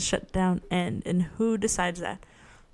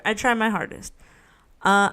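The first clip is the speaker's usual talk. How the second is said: ordinary talk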